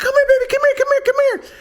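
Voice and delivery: loud high dog-calling voice; high coaxing call. Retold or imitated.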